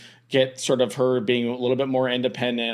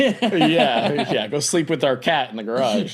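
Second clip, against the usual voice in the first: talking through laughter; real laughing; 4 semitones higher, 4 dB louder, slower than usual. Real, not acted. Co-host joins joke about sleeping in garage, laughing. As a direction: play along with the joke, chuckling.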